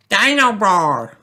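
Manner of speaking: high, slow, thrilled little voice; excited toddler. Acted. Toddler thrilled with his new toy, high slow excitement. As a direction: savour the name like a treasure. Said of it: "says George excitedly".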